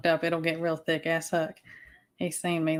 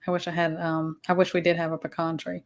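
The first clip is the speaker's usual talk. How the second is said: ordinary talk, nothing marked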